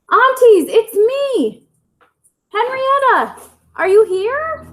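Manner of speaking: high, loud calling. Acted. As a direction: call out to find them. Calling for aunts.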